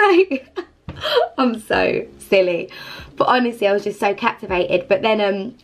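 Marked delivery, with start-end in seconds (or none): 0.0-2.7 giggly and slowed; laughing at herself. Real, not acted. Laughing at herself after the burning bush story, real giggle. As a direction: laugh off your own silliness.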